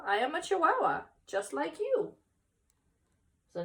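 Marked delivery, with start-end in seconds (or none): 0.0-2.2 cheerful reveal. Acted. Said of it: "said Scapito".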